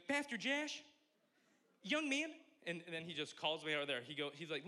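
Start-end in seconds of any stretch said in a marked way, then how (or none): 0.0-2.3 put-on high, loud accented call of an old preacher; piercing, accented preacher impression; Pastor Charlie's accent. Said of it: "I can't do the accent the whole time".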